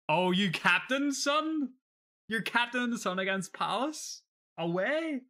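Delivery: can't believe the pick; amazed disbelief. Real, not acted. Reacting to a viewer captaining Son away, astonished.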